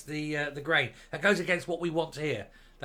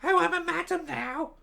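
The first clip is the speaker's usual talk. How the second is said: squeaky, prissy put-on voice, much higher; 13 semitones higher, 3 dB louder than usual.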